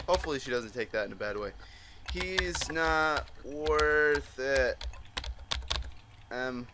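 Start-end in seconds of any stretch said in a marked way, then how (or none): 2.1-4.8 slow-motion line reading with long vowels; very slow, drawn out. Pretend. Player consoling crying Emily, very slow and drawn out. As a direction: stretch every word right out. Repeats game line very slowly.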